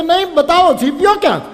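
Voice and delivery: loud, high angry threat with a laugh; hammed-up rage, hunting for the tongs; furious disciple. (ગુસ્સે ચેલો, ઊંચા મોટા અવાજે ધમકી)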